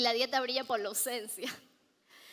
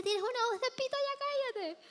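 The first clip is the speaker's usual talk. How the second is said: brother quoted; 10 semitones higher than usual. Retold or imitated. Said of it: "mi niño me decía".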